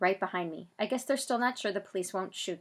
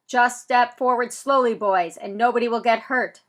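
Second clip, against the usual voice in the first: louder, slow police megaphone call; booming loudspeaker; 3 semitones higher, 10 dB louder than usual. Acted. Police calling to the boys over a megaphone. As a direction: boom it out over a bullhorn. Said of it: "the big microphone booms out".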